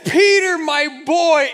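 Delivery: put-on the old dog calls to his boy at full voice. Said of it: "He says".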